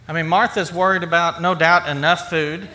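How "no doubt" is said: ordinary delivery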